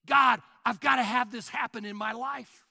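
loud, urgent plea to God; intense prayer. Acted. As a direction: cry out as if you must have it. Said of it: "you ask Him with intensity".

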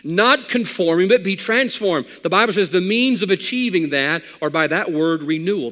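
loud, high preaching cry; full-force preaching. Acted.

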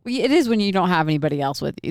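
ordinary talk

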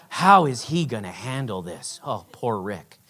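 low, soft sympathy; mock pity. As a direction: pity him quietly, deep in the voice. Acted pity for youth pastor.

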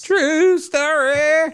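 very high, slow drawn-out catchphrase; TV catchphrase impression. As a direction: stretch it like the TV intro. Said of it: "every time I hear somebody say".

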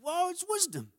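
stunned by sudden wisdom; awed surprise. Retold or imitated. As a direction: pitch leaps way up in wide-eyed wonder. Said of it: "I'm like".

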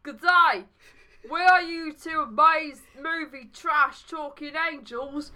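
high, slow, hammed-up Australian man; Aussie accent. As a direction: put on an aggressive, broad Aussie accent. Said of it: "should have done that in an Aussie accent".

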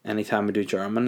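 ordinary delivery

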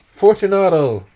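high, loud impatient call; calling aloud. A narrator's reading. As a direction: call into the niche. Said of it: "I called aloud".

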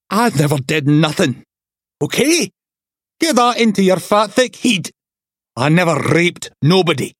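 high furious protest, acted; angry denial. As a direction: lose his temper at the fat detective. Suspect shouting denial at detective.

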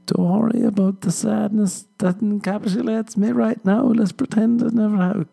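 high, forced brightness over tears; a fake cheerful cover for crying; mock breezy denial. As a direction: brush off your own tears cheerily.

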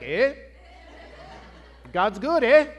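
mock Canadian sermon tag. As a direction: preach it with a Canadian "eh". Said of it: "start talking like that".

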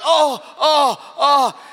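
hammed-up groans of a man in pain; mock groaning. Husband lying hurt in bed complains to his wife, mock groans. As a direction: moan like a helpless guy with a bad back. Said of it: "and I'm complaining".

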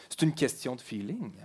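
hushed ominous delivery; low dark murmur. Pretend. Dark murmured repeat of refrain.

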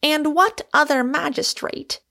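high, declaiming challenge. A narrator's reading. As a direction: raise the pitch and challenge them. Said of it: "raising his voice".

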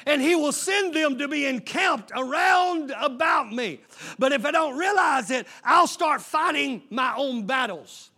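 loud, rising preacher cadence; Sunday-morning pulpit fire; preaching. Acted. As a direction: preach it out to the whole church. Builds back to full preaching.

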